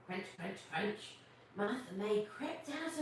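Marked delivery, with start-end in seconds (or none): 0.1-1.1 quiet crunch, crunch, crunch like someone chewing; soft crunching sounds. Acted. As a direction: make the munching sound softly. Imitating loud eating.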